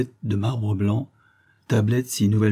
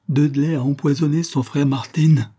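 higher, louder denunciation; Agnès exposing the husband, loud accusation; dramatic accusation; 6 semitones higher, 5 dB louder than usual. Acted. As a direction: hurl the accusation. Revealing the murder.

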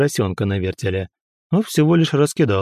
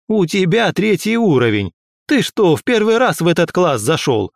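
angry scolding; 6 semitones higher than usual. Acted. Teacher keeps scolding angrily.

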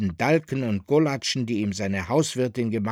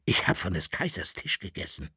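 leise, erschüttert; 6 semitones lower, 5 dB quieter than usual. Acted.

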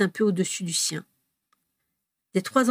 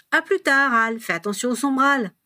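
high, louder parting shot; teasing call; 5 semitones higher, 4 dB louder than usual. A narrator's reading. James needling Albus as he leaves, teasing call.

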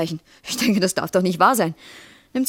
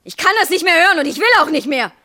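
loud, high cry of refusal; angry outburst; 7 semitones higher, 4 dB louder than usual. Acted. Wife cutting off her husband's excuses, angry outburst. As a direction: explode at your partner, voice raised. Wife snaps during quarrel.

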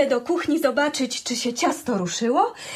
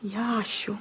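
whisper; 5 semitones lower, 6 dB quieter than usual. Acted. Wife coaxing grumpy husband.

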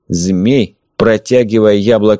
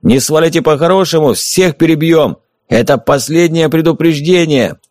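yelling threat; 6 semitones higher than usual. A narrator's reading. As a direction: bark threats at the men outside. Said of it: "заорал".

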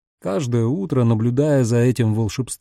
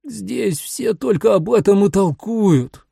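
higher, slow whiny complaint; plaintive moan; 7 semitones higher, slower than usual. Acted. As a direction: moan it pitifully, dragging the words. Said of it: "простонал Тони жалобно". (жалобный стон)

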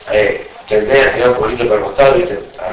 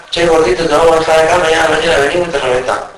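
louder, higher menacing thug voice; mock threatening robber; 5 semitones higher, 4 dB louder, faster than usual. Robber telling the captive they'll return, mock menacing. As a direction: threaten him like a thug leaving.

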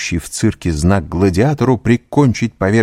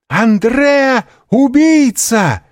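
очень высокий протяжный крик ужаса; закричи во весь голос, протяжно; женский крик; 6 dB louder, much slower than usual. A narrator's reading. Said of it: "крикнула одна из молодых женщин". (испуганный крик)

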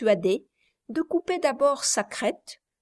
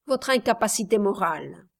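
plain reading voice

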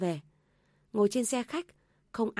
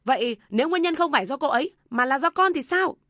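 loud, higher, quick retort; raised voice; 6 semitones higher, 7 dB louder than usual. A narrator's reading. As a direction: fire back at mother, no longer holding it in. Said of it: "Anh lớn tiếng". (con trai lớn tiếng vặn lại, dồn dập)

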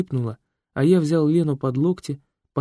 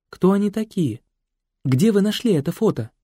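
puzzled; 6 semitones higher, slower than usual. Acted. Хозяйка puzzled by the photo.

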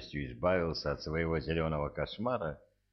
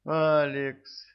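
high, louder surprised call; surprised exclaim; 9 semitones higher, 5 dB louder than usual. A narrator's reading. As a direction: cry out his name, startled. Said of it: "воскликнула Тенни".

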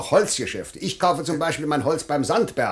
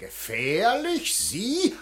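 scornful disbelief; 5 semitones higher, much slower than usual. Acted. Official mocks the threat.

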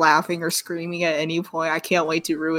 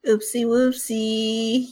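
high, drawn-out silly singsong; slow, mock-cute and higher; cutesy echo; 5 semitones higher, 4 dB louder, much slower than usual. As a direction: stretch it out in playful baby talk. Listener echoes the cutesy apology.

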